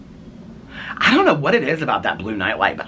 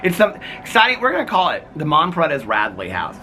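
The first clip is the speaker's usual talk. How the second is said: announcer voice; 8 semitones higher, 3 dB louder than usual. Pretend. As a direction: announce it like a game show host. Playful mock-announcer intro.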